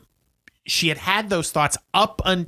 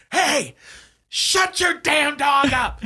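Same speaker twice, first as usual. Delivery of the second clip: yelling down; 9 semitones higher than usual. Retold or imitated. Will Ferrell yelling at neighbors from a balloon, hollering. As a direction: bellow an irate complaint down at the neighbors. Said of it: "starts to yell down to the neighborhood".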